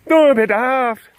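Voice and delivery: very high, loud cartoon Scottish; Scottish-leaning mock accent. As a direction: put on a silly, piercing Scottish brogue. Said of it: "I was about to do Scottish".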